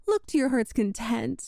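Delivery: ordinary delivery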